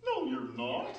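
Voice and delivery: ordinary delivery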